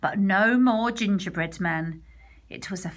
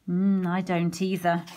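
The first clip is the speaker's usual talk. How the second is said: plain reading voice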